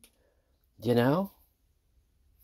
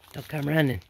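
ordinary delivery

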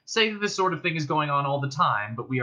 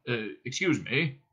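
put-on polite old man; 3 dB quieter than usual. A narrator's reading. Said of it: "coughed politely".

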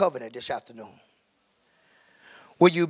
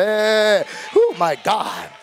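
fervent exclamation; 4 semitones higher, 4 dB louder, much slower than usual. Real, not acted. Preacher exclaims at the peak.

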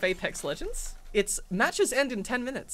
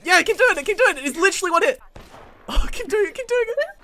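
high, loud egging-on; excited urging; 9 semitones higher, 7 dB louder than usual. Real, not acted. Player cheering teammate at the last enemy, excited urging. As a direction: urge them on excitedly to finish it.

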